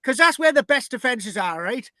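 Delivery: high, loud hammed-up Irish voice; mock Irish. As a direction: play the plotting Irish raiders, overdone. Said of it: "They're thinking".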